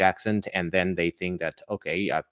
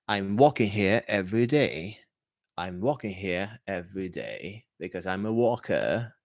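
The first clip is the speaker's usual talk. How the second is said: non-rhotic British, working said with no R; British accent. Shown as a demonstration. English teacher contrasting accents for students, British accent. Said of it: "Walking would be British".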